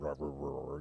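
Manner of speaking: low, soft rah rah grumbling; imitating the dog's grumble. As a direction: growl it quietly and low. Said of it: "he's got that grumble".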